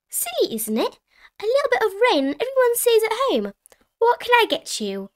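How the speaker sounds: high, lively shop assistant voice; chatty shopkeeper. A narrator's reading. Shopkeeper Miss Rabbit chats to customers.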